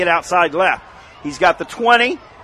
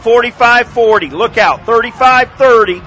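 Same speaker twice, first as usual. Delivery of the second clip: loud, quick yard countdown; rising play-by-play call; 3 semitones higher, 6 dB louder than usual. Real, not acted. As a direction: call the yards as he breaks free. Live run call, yard countdown.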